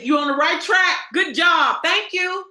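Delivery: cheery praise. Retold or imitated. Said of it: "Everybody just supports you and say".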